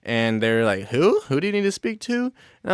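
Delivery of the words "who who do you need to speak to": high, puzzled clerk voice, pitched up; imitating confused clerks. Store clerk asking the fake CIA agent who, high and puzzled. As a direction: play the baffled shop worker.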